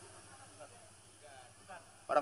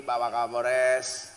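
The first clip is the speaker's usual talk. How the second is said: normal talking voice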